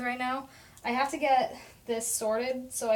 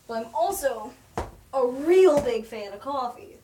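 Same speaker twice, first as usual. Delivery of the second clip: excited; 7 dB louder than usual. Real, not acted. Gushes about coffee.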